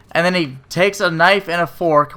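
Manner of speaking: normal talking voice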